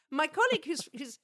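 ordinary talk, nothing marked

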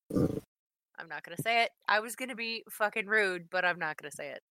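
ordinary delivery

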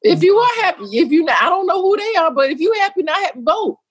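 fired-up high shout: vote; shouting urge. Real, not acted. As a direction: yell it out to the viewers, pitched high. Urging viewers to vote.

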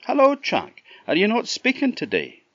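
high, light little bird voice; tiny voice calling up from the trough; wee robin voice. Acted. Robin greeting Jack at the trough, high wee voice. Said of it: "the voice said".

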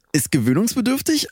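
excited. Acted. Tasting the new snack.

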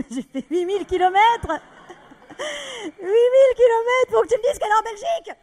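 high, loud, half-crying protest; exasperated yell. Acted. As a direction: shout in disbelief. Exasperated outburst. (cri exaspéré, aigu et fort)

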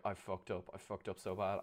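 low, soft retold self-reproach; retold upset. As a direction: quietly imitate someone upset, understated. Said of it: "she got really, really upset".

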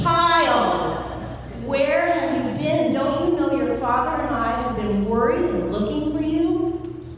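a mom who has been worried sick; scolding mom. Acted. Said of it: "She's a typical mom".

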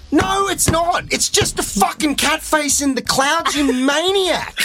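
high, incredulous protest; exasperated outburst. Real, not acted. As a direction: throw up your hands in disbelief. Exasperated rant at the director.

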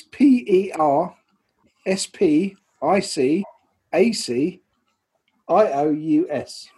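slow, letter-by-letter spelling. Shown as a demonstration. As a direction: spell it out in little groups, with pauses. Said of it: "I'll spell it for you".

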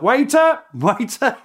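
a high, loud, cheery call of waiter; cheery shouted call. Retold or imitated. British soldiers teasing German ex-waiters, a high cheery shout. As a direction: sing it out across the trenches like teasing old friends. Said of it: "cheery, chirpy Cockney style".

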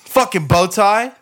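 high, loud, slow mocking yell; Jordan Belfort impression. Imitating Jordan Belfort mocking a bow tie, loud and high. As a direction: sneer it loudly like a raging boss. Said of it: "I'm Jordan Belfort".

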